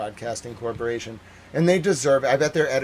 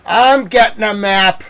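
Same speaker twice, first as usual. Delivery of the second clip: slower, loud and high; exasperated shout; 8 semitones higher, 11 dB louder, slower than usual. Real, not acted. Ranting to viewers about fetching a map, shouting. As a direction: bellow it out in exasperation.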